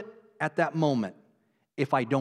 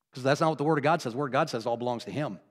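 normal talking voice

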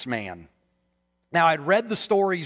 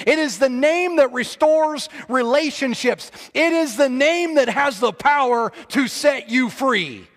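loud, high, rising sermon climax; fiery preaching; 8 semitones higher, 5 dB louder than usual. Acted. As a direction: preach it at full force. Builds to full preaching.

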